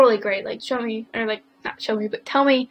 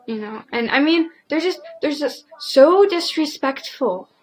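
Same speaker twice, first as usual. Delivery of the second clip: normal talking voice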